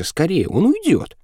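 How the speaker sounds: high-pitched cry without much volume; anxious, high little shriek; urgent girl's cry. A narrator's reading. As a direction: urge someone on in a high voice. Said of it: "закричала девочка".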